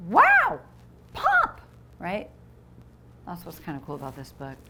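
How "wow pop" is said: high, loud bursts of wow and pop; sound-effect words. Shown as a demonstration. Teacher shows kids how onomatopoeias sound, loud and high. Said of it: "So when we're like".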